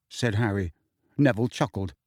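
ordinary delivery, nothing marked

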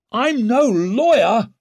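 high, loud, slow declaration; forceful public address; calling out. A narrator's reading. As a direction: call it out to the whole court. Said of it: "called Leo".

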